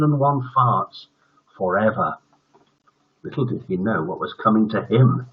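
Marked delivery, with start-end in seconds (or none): none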